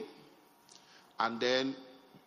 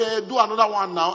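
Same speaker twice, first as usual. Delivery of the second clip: louder, pleading demand; insisting buyers; 7 semitones higher, 9 dB louder than usual. Retold or imitated. Buyers insisting he build another, louder pleading demand.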